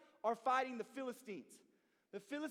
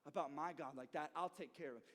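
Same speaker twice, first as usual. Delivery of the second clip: firm, quiet resolve; 6 semitones lower, 6 dB quieter than usual. Retold or imitated. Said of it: "David says".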